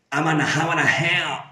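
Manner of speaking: words slurred into one another; drunk rowdy Scottish singer. Retold or imitated. Teacher imitates AC/DC's singer for learners, drunken slur. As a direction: mash the words together like a drunken rocker. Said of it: "borracho permanentemente, gamberrete así como era".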